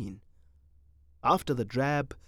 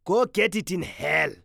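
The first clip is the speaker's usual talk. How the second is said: rude retort; 4 semitones higher, 5 dB louder than usual. Acted.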